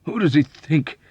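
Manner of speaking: high, loud and indignant; indignant demand. A narrator's reading. Sneezing airman to his friend about a heckler, indignant demand. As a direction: demand it, worked up. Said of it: "he demanded, sniffing spasmodically".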